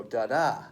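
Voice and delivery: second da of "da-da" stressed. Shown as a demonstration. Teacher restates how feet go, stress on second da. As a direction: lean into the second "da" of "da-da". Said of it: "they go da-da instead of da-da".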